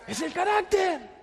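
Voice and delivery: high, drawn-out exclamation; emphatic cry. Real, not acted. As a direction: proclaim the answer slowly and high. Shouts the key point. (exclamación enfática)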